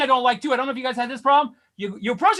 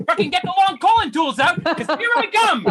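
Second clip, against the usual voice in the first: indignant sarcasm; 4 semitones higher than usual. Acted. Patient hitting back at doctor over his long colon, indignant sarcasm. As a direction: protest with exaggerated, offended sarcasm.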